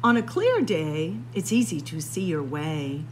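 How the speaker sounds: plain reading voice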